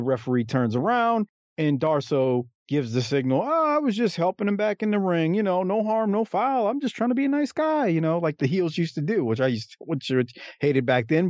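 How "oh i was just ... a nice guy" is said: innocent heel. Retold or imitated. Heel excusing himself to referee.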